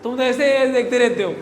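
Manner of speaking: teacher losing patience; exasperated scolding. Real, not acted. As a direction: tell off an unresponsive class loudly. Teacher scolds class for silence.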